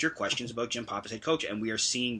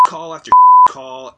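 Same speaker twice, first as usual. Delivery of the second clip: shrieked far higher and much louder; angry yell; 18 dB louder than usual. Real, not acted. Ranting to his co-host about refs, angry yell. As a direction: explode at the endless bad calls. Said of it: "I was so angry at this".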